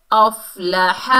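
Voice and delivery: अफ़लाह with lam and ha unstretched; अफ़लाह with the big ha from the throat; recited short. Shown as a demonstration. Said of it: "दोनों को बगैर खींचे पढ़ेंगे". (बगैर खींचे अफ़लाह)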